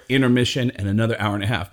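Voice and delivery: usual speaking voice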